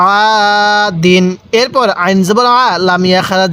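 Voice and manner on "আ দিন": "আ" held out, then short "দিন"; one-alif madd. Shown as a demonstration. Teacher shows the empty-alif rule, stretched "আ" before "দিন". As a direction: draw out the "আ" before saying "দিন". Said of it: "এক আলিফ টান দিব".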